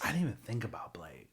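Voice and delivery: soft unvoiced whisper; whispered aside. Real, not acted. Host quietly admitting to a co-host they missed Blade, whispered. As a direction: murmur it to yourself as a whisper.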